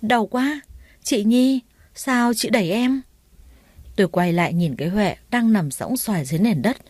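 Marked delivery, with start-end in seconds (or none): 0.0-3.0 higher, whimpering cry of pain. Acted. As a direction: wail that it hurts, playing the victim. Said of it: "một tiếng hét lớn vang lên".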